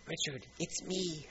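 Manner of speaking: lightly voiced God, quietly getting someone's attention; God tapping gently. God tapping Richard to say it's me, soft playful call. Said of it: "I almost picture God, like, tapping".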